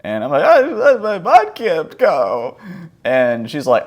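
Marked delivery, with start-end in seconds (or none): 0.4-2.6 high, loud, slow wail; hammed-up sobbing kid; mock hysterical crying. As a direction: imitate a child bawling out a confession. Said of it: "crying hysterically".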